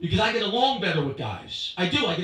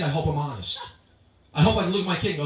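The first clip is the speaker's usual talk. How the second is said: ordinary talk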